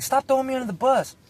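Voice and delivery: high-pitched mock complaint; retold exasperated protest. Son's unspoken plea to mom, retold as a high exasperated complaint.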